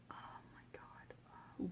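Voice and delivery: breathless frightened whisper, no voice at all; retold whisper. Scared teen whispering to her friend in the dark. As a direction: hush it like someone might hear.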